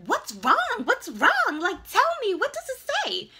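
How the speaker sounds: high-pitched urgent demanding; frantic friend. Retold or imitated. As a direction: press for answers in an alarmed, high voice. Voices frantic homegirl on phone.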